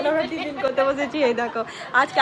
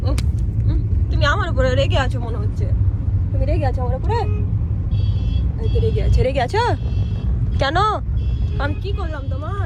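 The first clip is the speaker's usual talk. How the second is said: louder, sing-song coaxing; playful louder coaxing; 3 semitones higher, 8 dB louder than usual. Real, not acted. Coaxing a grumpy baby.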